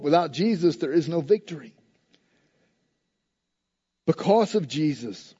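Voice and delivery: usual speaking voice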